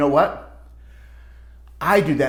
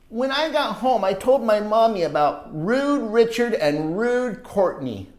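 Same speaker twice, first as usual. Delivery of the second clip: put-on lively read-aloud for kids; storybook narration; 6 semitones higher than usual. A narrator's reading. Reading a story to children, animated narration. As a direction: tell it like a picture book to children. Reads picture book aloud.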